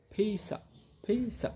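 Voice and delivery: "ফিসা" said softly, the "অফ" shrunk to a short "আ"; schwa drill. Shown as a demonstration. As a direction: say "ফিসা" quietly, blending piece and of. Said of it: "শোয়া সাউন্ডে নিয়ে আসবো". (নরম স্বরে "ফিসা" উচ্চারণ)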